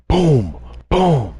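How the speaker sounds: high mock door bangs, boom boom; imitated banging. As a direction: knock out the bangs with your voice. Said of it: "and I hear bangs".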